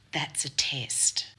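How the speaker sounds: slow, whispery mock-grave delivery; drawn-out, hushed pronouncement; hushed reveal. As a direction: imitate a boss solemnly whispering a verdict. Boss's retold reveal.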